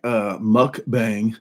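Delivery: "mukbang" stretched out slowly in a puzzled voice; drawn-out mukbang. Retold or imitated. Retelling his puzzled reaction to a friend's tip, slow drawn-out "mukbang". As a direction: sound out "mukbang" slowly, like a strange new word. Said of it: "I'm like".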